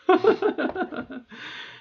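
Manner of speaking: high run of laughter; laughing. Real, not acted. Amused at own voice exercise, laughing high. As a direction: burst into high giggles.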